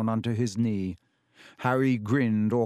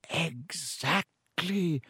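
higher, very slow, glowing exactly; beaming; 6 semitones higher, 4 dB quieter, much slower than usual. A narrator's reading. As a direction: reward him with a beaming word. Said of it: "beaming once more".

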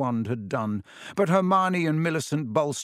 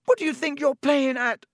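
high, loud furious yell; angry scared shout; 13 semitones higher, 4 dB louder than usual. A narrator's reading. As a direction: lash out in fear. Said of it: "he shouted".

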